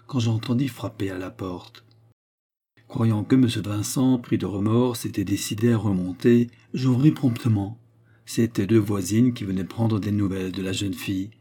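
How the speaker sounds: usual speaking voice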